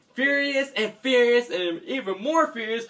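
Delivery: high, mounting excitement; excited build-up. A narrator's reading. Child narrator building up the fury.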